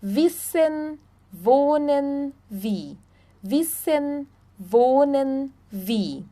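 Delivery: "Wissen", "wohnen", "wie" beginning with V. Shown as a demonstration. Teacher explaining German W, V sound in "Wissen", "wohnen", "wie". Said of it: "W em alemão sempre vai ter som de V".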